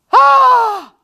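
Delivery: sudden high loud cry of frustration; frustrated yell. Retold or imitated. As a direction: let out a sharp pent-up yell. Frustrated outburst as a child.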